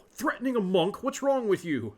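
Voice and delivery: shocked outrage at being threatened; offended monk, loud and high; outraged. Acted.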